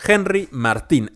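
Martín stressed on the final í, said slowly; Henry Martín said deliberately slow, stress on TÍN; accent on the i. Shown as a demonstration. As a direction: lean on the last syllable of Martín, mar-TÍN. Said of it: "así con acento en la I".

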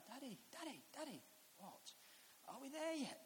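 high, soft, small voice; imitated toddler, high-pitched and insistent; pestering little child. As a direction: nag Daddy like a three-year-old. Small child pestering dad in car.

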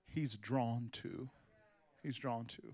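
low, soft and slow; hushed emphasis. Acted. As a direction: let it sink in almost whispered. Hushed repeated climax.

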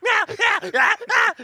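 loud, high meowing; begging cat. Pretend. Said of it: "Do you see me doing this".

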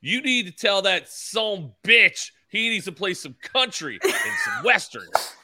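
put-on loud, slow, high-pitched drunk heckling; drunken fan. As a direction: holler it like a rowdy drunk fan, drawn out. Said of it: "To quote the drunken fan".

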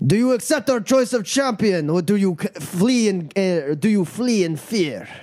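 high, declamatory challenge to the champion; ceremonial fight master. Acted. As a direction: proclaim it to a hushed crowd. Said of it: "Boris raises his hands up".

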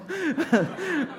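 laughing out high and loud; laughing at himself, high and loud. Real, not acted. Big laugh.

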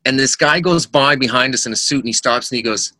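usual speaking voice

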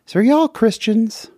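retold question in a mild Southern accent. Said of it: "She said in her Southern accent".

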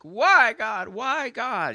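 high-pitched imitated wail; acted anguished cry; crying out to God. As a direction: call it out high like a desperate plea to heaven. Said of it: "she just starts crying out to God".

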